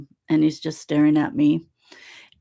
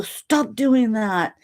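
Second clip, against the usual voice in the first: annoyed protest; 6 semitones higher, much slower than usual. Retold or imitated. Telling Alon to stop staring.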